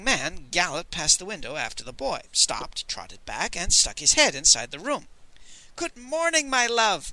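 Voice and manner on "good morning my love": much higher, bright lilting greeting; cheerfully. Acted. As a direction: greet her with sunny cheer. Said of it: "said cheerfully".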